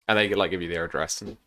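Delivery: ordinary talk, nothing marked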